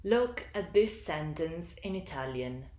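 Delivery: ordinary talk, nothing marked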